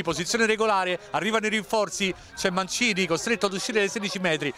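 ordinary talk

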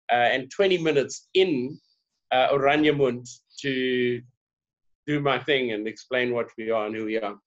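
ordinary talk

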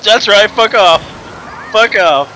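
loud gloating fuck off; loud taunt. Real, not acted. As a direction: jeer it at them.

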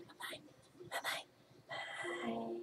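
hushed voiceless farewell; whispered bye-bye. Real, not acted. Owner whispering goodbye to her ferret. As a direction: breathe the goodbye softly.

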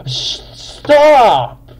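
high, loud mad yell; exasperated shout. Real, not acted. Gamer provoked by another player, loud angry shout. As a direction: burst out at someone provoking you. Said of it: "trying to make me mad".

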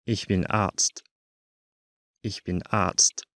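ordinary talk, nothing marked

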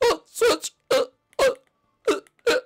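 very high, broken sputtering; panicked stammering. Acted. Junior sees the Switch in water, panicked stammering. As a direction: stammer in high-pitched horror, unable to finish.

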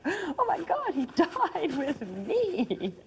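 high, drawn-out awed delight; mock-awed thrilled exclamation; very excited. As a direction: gush it with a laugh, high and slow. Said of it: "then I got very, very excited".

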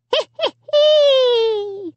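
high, drawn-out evil snicker; creepy puppet giggle, last note held long and high. A narrator's reading. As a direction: squeal out a wicked hee-hee-hee. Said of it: "let out a soft, evil".

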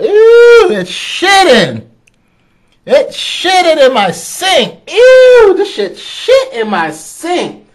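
very high, loud, slow grossed-out cry; high shrieking ew, then slow appalled complaint; disgusted shriek. Real, not acted. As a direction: recoil in disgust as the crab fouls the sink. Crab poops in his sink.

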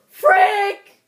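very high, loud shriek; real high-pitched freak-out; panicked scream. Real, not acted. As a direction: scream in sudden terror, breaking off mid-word. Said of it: "I've never heard you scream like that".

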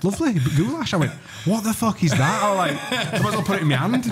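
mock outraged friend. Friend mocking Brian's soup complaint in a high squeaky voice. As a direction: send up his fuming over the soup in a high voice.

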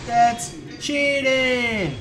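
loud, high, very drawn-out complaint; whiny protest. Real, not acted. Player accusing the ghost of cheating, whiny protest. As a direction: stretch both words in slow indignation. Complaining about game enemy.

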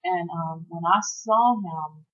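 ordinary delivery

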